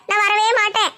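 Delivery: usual speaking voice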